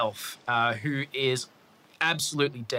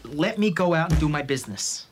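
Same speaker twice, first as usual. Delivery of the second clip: higher, insistent in-character outburst; acted fed-up rant, louder at the peak; exasperated demand; 6 semitones higher, 4 dB louder than usual. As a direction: argue heatedly, pitch climbing. Manager demands a guard, argues.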